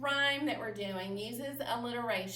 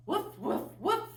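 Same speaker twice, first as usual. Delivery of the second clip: playful puppy woof; 8 semitones higher than usual. Shown as a demonstration. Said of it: "your animal sounds".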